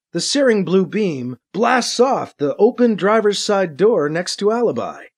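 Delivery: usual speaking voice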